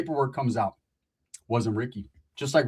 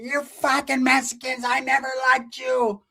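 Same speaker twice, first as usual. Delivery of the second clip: higher, slow mock old lady; angry old mom; 8 semitones higher, slower than usual. Roach's mom swinging a broom, slow shrill. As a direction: drag out an old woman's insults. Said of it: "Roach's mom came out".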